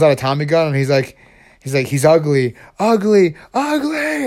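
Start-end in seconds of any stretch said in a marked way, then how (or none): 1.9-4.3 climbing higher with each ugly; insane villain. Acted. Mad surgeon opens fire on intruder, insane and escalating. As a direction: build to a high-pitched crazed cry. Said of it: "'cause he's insane".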